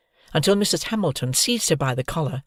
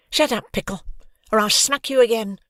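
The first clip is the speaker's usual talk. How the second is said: warning; 7 semitones higher than usual. Acted. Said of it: "Hoggy warned him".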